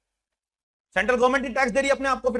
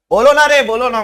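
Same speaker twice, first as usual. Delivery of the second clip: urging shout; 4 semitones higher, 6 dB louder than usual. Real, not acted. Teacher urging silent students.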